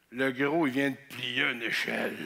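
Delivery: low, deadpan disbelief; onlooker's low, grave remark; stunned deadpan. Acted. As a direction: state the disaster in a low monotone. Stunned buddies watching him.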